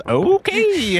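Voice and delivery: bandit's exaggerated okay. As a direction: squeal out a long, cartoonish okay. Game master voices bandit leaving bar.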